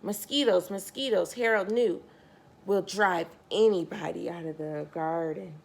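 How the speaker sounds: ordinary delivery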